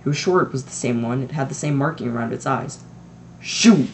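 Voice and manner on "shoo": sharp loud shout to chase a cat; loud shoo. Acted. Mr Dursley shooing the cat off his wall, loud. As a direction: bark it at the cat. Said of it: "said Mister Dursley loudly".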